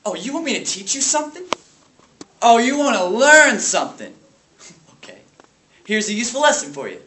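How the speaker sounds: taunting, high swooping questions; mock the class loudly with exaggerated melody; raging substitute teacher. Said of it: "lash out his rage at the small private school".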